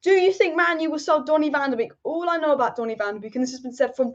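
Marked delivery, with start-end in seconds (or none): none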